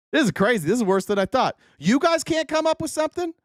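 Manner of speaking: incredulous, pitch climbing high; can't believe the audience is this useless; high-pitched, baffled challenge to the listeners; exasperated disbelief. Real, not acted. Exasperated at listeners' weak answers.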